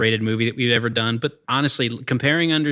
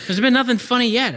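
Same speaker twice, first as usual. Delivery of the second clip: raised, pitched-up complaint; exasperated; 12 semitones higher, 3 dB louder than usual. Retold or imitated. Friend complaining about the movie, high exasperated whine. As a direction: act out frustration at a boring film.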